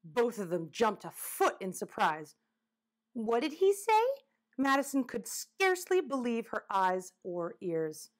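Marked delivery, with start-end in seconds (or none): 3.1-4.0 high-pitched, startled disbelief; astonished question. Acted. Child hears a woodchuck talk, astonished. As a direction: ask it in high-pitched amazement.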